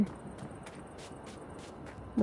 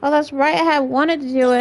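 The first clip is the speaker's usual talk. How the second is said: real raised voice, louder than needed; yelling; 3 semitones higher, 5 dB louder than usual. Real, not acted. Streamer suddenly remembering an unfinished memory, yelling. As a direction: blurt it out too loudly. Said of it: "I don't know why I'm yelling".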